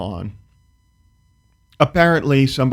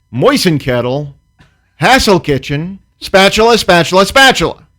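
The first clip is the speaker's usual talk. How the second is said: loud, higher triumphant listing; mocking his inflection; 7 semitones higher, 8 dB louder than usual. Mimicking the neighbor's gloat, loud and high. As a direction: crow each word back at him. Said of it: "matched his inflection, his tone".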